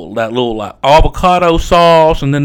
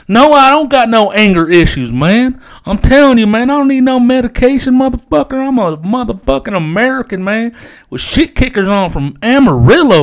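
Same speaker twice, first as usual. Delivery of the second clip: higher-pitched, worked-up denial; mock angry protest; 8 semitones higher than usual. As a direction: protest indignantly in character. Acted angry denial of anger issues.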